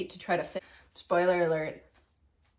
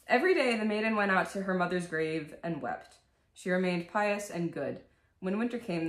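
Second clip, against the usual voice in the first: bedtime story reading; 6 dB quieter than usual. Acted. Vlogger reading Grimm's Cinderella to viewers, soft storybook voice. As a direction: read it like a scary bedtime tale.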